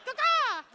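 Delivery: very high double squawk; cartoon crow call; bird caw. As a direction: screech it like a bird. Repeats the bird bit.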